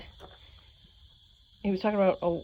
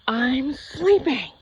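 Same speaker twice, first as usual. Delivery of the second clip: slow, drowsy answer; sleepy reply; 4 semitones higher, much slower than usual. Retold or imitated. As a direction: drag it out half asleep. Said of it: "And I'm like".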